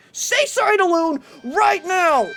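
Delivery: slow, high, stern scolding; stern parent voice. Acted. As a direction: draw it out like a strict mom. Scolding a chat member, repeated.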